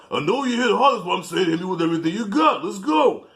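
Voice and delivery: egging his partner on to hit for real; pumped-up Sly. Retold or imitated. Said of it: "and he says".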